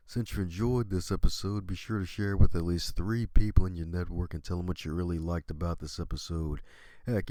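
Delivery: upbeat outro announcer read; cheery sign-off to listeners; podcast outro read. Acted. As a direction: wrap up the show like a radio host.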